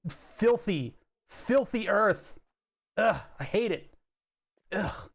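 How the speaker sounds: slow, high, hammy revulsion at filthy ground; mock disgust. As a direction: spit out filthy like a snob. Feet touched the earth, disgust.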